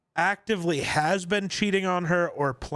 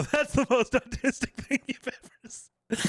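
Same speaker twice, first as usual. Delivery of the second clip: mocking friend's catchphrase; 9 semitones higher than usual. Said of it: "You go".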